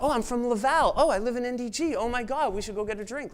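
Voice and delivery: hammed-up squeal of delight; gushing excitement. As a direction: gush like meeting a long-lost friend. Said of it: "And they're like".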